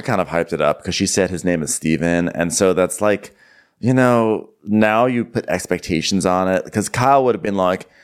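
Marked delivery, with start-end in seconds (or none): none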